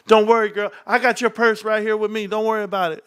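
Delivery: high, smooth-talking put-on voice; Reek. Friend Reek dancing with all the purses, high mock reassurance. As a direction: reassure her in a high, playful imitation. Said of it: "Reek's like this".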